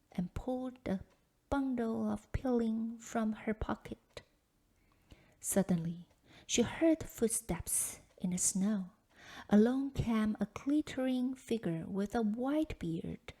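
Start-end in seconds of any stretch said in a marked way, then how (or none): none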